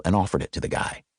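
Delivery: plain reading voice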